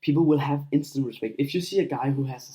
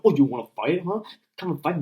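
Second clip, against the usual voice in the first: louder, rising cocky challenge; mock meathead; 5 semitones higher, 4 dB louder than usual. Meathead daring someone to fight, cocky and loud. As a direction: puff up like a meathead picking a fight. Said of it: "I'm not telling you to be that meathead".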